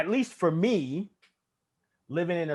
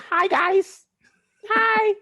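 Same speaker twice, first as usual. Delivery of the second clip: much higher, sweet and harmless "hi"; 13 semitones higher, much slower than usual. Retold or imitated. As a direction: raise the voice way up to sound unthreatening. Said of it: "I gotta be like".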